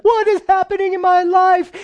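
high, loud frantic wail; panicked cry. Retold or imitated. A believer crying out to God in sudden panic, high and loud. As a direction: cry out as if your world is falling apart.

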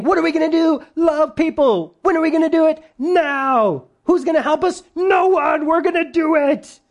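high, rallying call-and-response chant; hammed-up pep talk pitched well up; motivational speech. Churches rallying people to love, as a mock motivational speech. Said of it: "It's a big motivational speech".

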